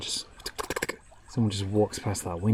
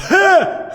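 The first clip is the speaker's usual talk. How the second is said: sharp high startled gasp; 13 dB louder than usual. Retold or imitated. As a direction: jump at the knock on the glass. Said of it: "I was like".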